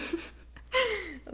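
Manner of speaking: laughing high and bright; high burst of laughter. Real, not acted. Laughs after the interruption.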